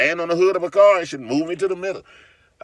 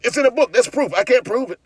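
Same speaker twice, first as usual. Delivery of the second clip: sarcastic mockery; 9 semitones higher, 4 dB louder than usual. Mocking the book-believers.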